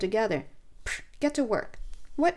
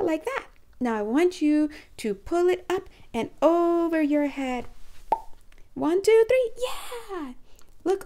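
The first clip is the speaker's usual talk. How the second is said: put-on talking to a child; 5 semitones higher than usual. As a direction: coax the inner child like a preschool teacher. Leads inner child play, childlike.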